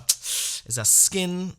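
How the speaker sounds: very slow, high and strained; slow hesitant fib; stalling excuse. Retold or imitated. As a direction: stretch it out while you invent a lie.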